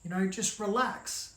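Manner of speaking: put-on soft, unhurried old man soothing her; calm her down gently like nothing is wrong; cool and calm. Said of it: "like, all cool and calm".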